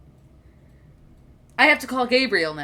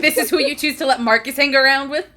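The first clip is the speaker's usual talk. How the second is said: louder, incredulous rising question; exasperated disbelief; 4 semitones higher, 6 dB louder, faster than usual. Acted. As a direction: throw up your hands at Nigel. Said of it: "looks at Nigel like".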